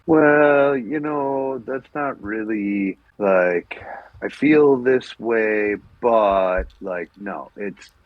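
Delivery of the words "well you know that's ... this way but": drawn-out, hedging mock reluctance; mock hesitant. Podcaster parodying people who sugarcoat opinions, mock hesitant. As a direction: hem and haw, stretching the words.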